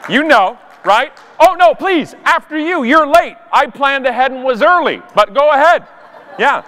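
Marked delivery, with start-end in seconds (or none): 1.4-5.9 high, over-polite mock courtesy; sweetly insist, dripping with resentment; passive-aggressive politeness. Waving late people ahead in line, passive-aggressive politeness. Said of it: "passive-aggressive politeness".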